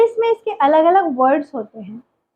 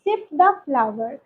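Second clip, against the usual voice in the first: slow, deliberate teacher model sentence; 2 semitones lower, 4 dB quieter, much slower than usual. Shown as a demonstration. Teacher modelling a sentence slowly for learners. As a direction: say it slowly so students can repeat. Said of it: "तब हम बोलेंगे".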